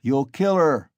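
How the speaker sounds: loud protest; warning shout. A narrator's reading. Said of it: "shouted the third".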